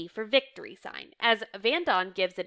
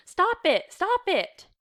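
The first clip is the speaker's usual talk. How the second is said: high, raised cry to stop; urgent protest; 10 semitones higher, 6 dB louder, slower than usual. A narrator's reading. Reader voicing someone halting a quarrel, urgent protest. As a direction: cry out sharply to break it up. Acted quarrel over dividing.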